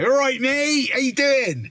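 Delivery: high, loud caricature of a Bristolian greeting; Bristolian accent. Retold or imitated. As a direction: imitate a West Country local standing out like a sore thumb. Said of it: "Bristolian or something on a fucking".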